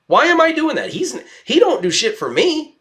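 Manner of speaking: high, exasperated outburst; fed-up rhetorical question. Real, not acted. Venting about a boss he resents.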